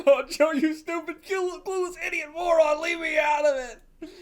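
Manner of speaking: high, loud scolding yell; exasperated yelling. Real, not acted. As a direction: yell at him, fed up and laughing. Yelling insults at the character.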